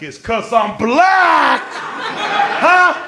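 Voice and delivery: high, loud, slow accusing yell with laughter. Retold or imitated. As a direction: yell it at the cop so people turn around. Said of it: "What's this dude yelling about".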